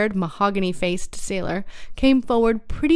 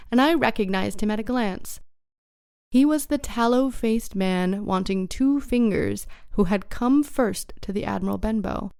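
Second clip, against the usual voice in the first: ordinary delivery, nothing marked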